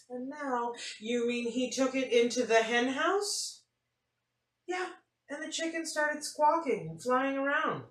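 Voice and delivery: lively storybook read-aloud; animated picture-book reading; reading to children. A narrator's reading. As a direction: tell it like story time for kids.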